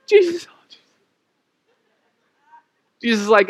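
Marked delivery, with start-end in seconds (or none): 0.1-0.8 very high mock wail; hammed-up panicky cry to heaven; dramatic believer. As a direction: overwrought plea, played for laughs. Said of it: "like, dramatic believers".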